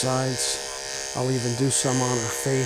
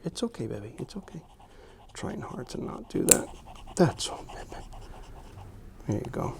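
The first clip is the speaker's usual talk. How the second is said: whispered, slowed reassurance; quietly calm a nervous pet; soothing a scared dog; 6 dB quieter than usual. Real, not acted.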